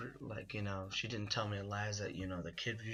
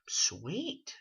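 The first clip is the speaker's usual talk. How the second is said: put-on cheeky kid exclamation; 7 semitones higher, 7 dB louder than usual. Kid's cheeky reply to mom.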